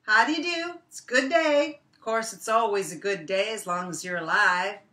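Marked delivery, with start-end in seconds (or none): 0.0-1.8 much higher, louder, cheery rabbit voice; cheerful Brer Rabbit. Acted. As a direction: greet the stranger brightly, up high. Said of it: "And he says".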